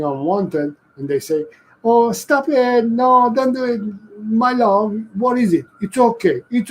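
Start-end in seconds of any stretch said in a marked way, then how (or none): 1.8-3.8 sweet cooing. Retold or imitated. Owner mock-scolds dog too sweetly. 4.2-6.7 put-on high, sugary baby talk to a pet; cooing owner. Doting owner coos at misbehaving dog, high and sugary.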